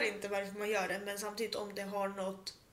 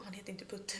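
whispered aside; 3 semitones lower, 8 dB quieter than usual. Real, not acted. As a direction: let the aside slip out in a whisper. Secret aside about coworker. (viskande sidokommentar)

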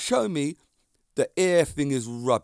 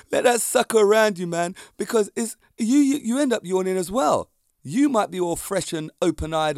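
excited; 6 semitones higher, 4 dB louder than usual. Real, not acted. Excited rant about contagious yawns.

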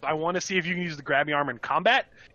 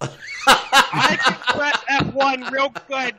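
high, slow, laughing dopey voice; laughing silly voice; 9 semitones higher, 6 dB louder, much slower than usual. Acted. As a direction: play dumb through laughter. Laughing mock dumb voice.

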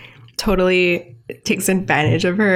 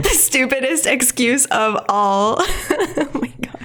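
laughing; 5 semitones higher than usual. Real, not acted. Laughs heard around, mid-giggle.

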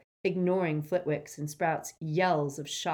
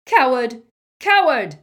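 much louder, higher shouting; furious cry; 8 semitones higher, 15 dB louder than usual. Acted. McGonagall yelling after fleeing Snape, furious shout. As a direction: hurl it after him in fury. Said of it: "he heard McGonagall cry".

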